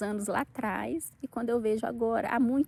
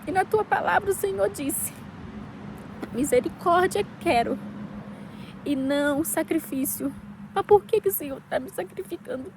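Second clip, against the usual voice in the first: real choked-up plea, pitched high; emotional, near tears; 6 semitones higher than usual. Real, not acted. Woman telling God nothing is enough, tearful pleading. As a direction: plead upward as tears break in. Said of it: "Eu fico até emocionada quando eu falo".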